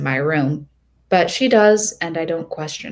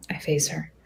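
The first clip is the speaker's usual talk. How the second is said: plain reading voice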